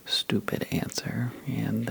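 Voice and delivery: plain reading voice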